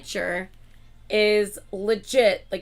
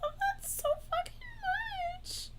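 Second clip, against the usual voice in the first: excited squeal; 11 dB quieter than usual. Real, not acted. Squealing over cute merch.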